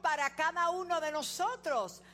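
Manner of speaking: loud, high preacher's cry; full-force preaching. Acted. Pastor rallies the congregation to praise, loud high preaching. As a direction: lift the room with loud praise.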